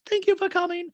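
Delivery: very high-pitched hostess impression; Dorit. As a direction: mimic a hostess greeting in falsetto. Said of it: "Dorit goes".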